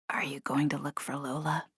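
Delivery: soft unvoiced whisper. A narrator's reading. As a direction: whisper so as not to wake anyone. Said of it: "Cleo whispered".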